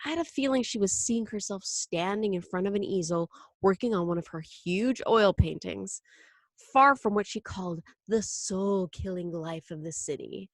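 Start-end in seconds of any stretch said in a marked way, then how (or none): none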